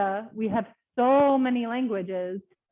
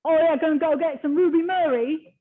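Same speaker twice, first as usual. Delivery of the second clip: loud, higher-pitched Cockney; imitated Cockney rhyming-slang speaker; Cockney accent; 5 semitones higher, 6 dB louder than usual. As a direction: put on a broad London Cockney voice. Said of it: "Cockney will say".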